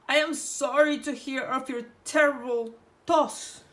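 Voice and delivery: wolf squeaks sweetly to fool Grandma; wolf faking a squeaky voice. A narrator's reading. Wolf pretending to be Roja, squeaky put-on voice. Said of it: "he said in a high, squeaky voice".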